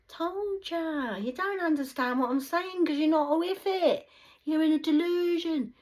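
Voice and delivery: loud, high ranting yell; shrill ranting at the judging viewers; high shout. Real, not acted. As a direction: yell it down at the doubters.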